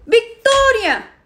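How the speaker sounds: drawn-out furious yell of the name; angry yell. Acted. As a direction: bellow her name, long and slow. Llama a gritos a su hermana. (grito furioso y alargado del nombre)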